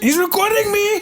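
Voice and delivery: mock freakout. Friend joins the mock freakout.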